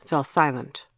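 plain reading voice

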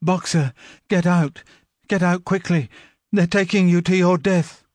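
high, frantic plea; desperate warning. A narrator's reading. Clover warning Boxer in desperation. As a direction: beg him to break free.

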